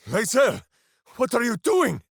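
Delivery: high, loud startled question; alarmed. Acted. Minsk startled by Lae'zel, alarmed cry.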